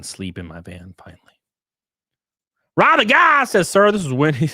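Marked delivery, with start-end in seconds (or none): 2.8-4.5 high and loud, calling out the joke; loud chat read. Real, not acted. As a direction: belt out the chat line. Reading chat loudly.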